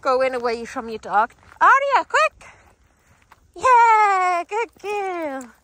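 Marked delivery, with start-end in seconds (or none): 1.6-5.5 high, loud call into a cheer; excited recall. Real, not acted. Trainer recalls distracted dog, excited shout and cheer. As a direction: call urgently, then celebrate. Urgent recall then praise.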